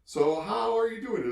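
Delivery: high, put-on American accent; fake American accent. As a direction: overdo a friendly American accent. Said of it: "fakes an American accent".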